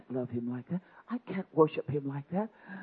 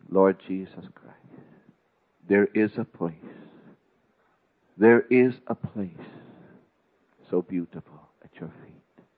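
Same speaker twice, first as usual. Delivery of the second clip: a heartfelt pulpit prayer; fervent prayer; 3 semitones lower, 7 dB louder than usual. Real, not acted. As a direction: pray aloud before the congregation. Preacher turns to prayer.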